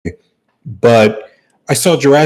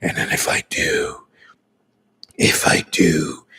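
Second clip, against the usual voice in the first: Batman whisper; 7 semitones lower, 5 dB quieter than usual. Pretend. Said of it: "the Batman voice".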